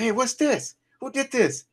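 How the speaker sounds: angry mom. Retold or imitated. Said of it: "I said".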